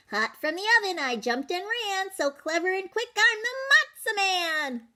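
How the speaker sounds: high, bouncy boastful chant; sing-song brag as the runaway cracker; cocky matzah man. Acted. Matzah man taunting the baker in a high boastful sing-song.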